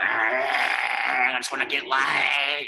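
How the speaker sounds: drawn out very slow, lazy lad imitation; mock young bloke. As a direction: stretch it out like a dim, horny guy. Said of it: "they're like".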